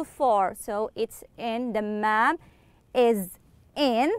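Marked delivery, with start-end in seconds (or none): none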